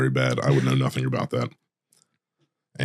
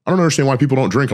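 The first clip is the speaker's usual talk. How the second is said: mocking bartender; 10 semitones higher, 3 dB louder, faster than usual. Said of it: "made some joke like".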